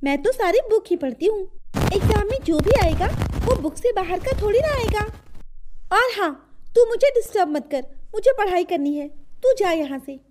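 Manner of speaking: put-on high, quick girl's voice; defiant studious sister. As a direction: brush off a pestering brother, high and fast. Girl character brushes brother off. (भाई को झिड़कती बहन की ऊँची आवाज़)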